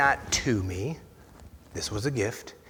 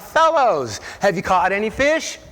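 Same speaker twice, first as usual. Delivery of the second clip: calling out; 5 semitones higher, 4 dB louder than usual. Acted. Jesus calling out to fishermen on the water, raised call.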